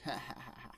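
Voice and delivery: put-on villain's chuckle; staccato fake ha-ha laugh; mock laughter. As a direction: imitate a creature laughing at a threat. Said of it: "just starts laughing and going".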